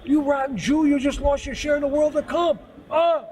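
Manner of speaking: retold yell, high and loud; furious shout. Angry man cursing the boy on the street, furious shout. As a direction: bellow the insult like an enraged stranger. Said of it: "And the guy flipped out".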